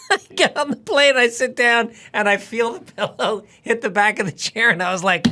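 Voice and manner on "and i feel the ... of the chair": ordinary talk, nothing marked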